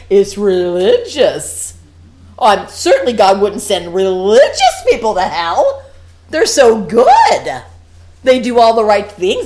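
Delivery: slow, loud sarcastic mock of pious people. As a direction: lay the sarcasm on thick, drawn out. Mocking religious self-image.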